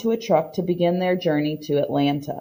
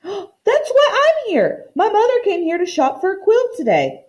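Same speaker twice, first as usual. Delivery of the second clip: put-on excited boy; 12 semitones higher than usual. Excited boy after gasp.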